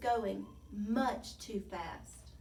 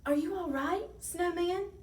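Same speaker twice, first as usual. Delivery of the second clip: high, tentative question; nervous; 9 semitones higher, 4 dB louder than usual. A narrator's reading. Tiny creeping up to the snow pile, nervous. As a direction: ask carefully, voice lifted. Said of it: "creeping nervously up".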